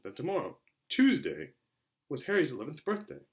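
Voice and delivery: ordinary delivery, nothing marked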